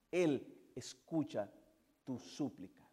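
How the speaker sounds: usual speaking voice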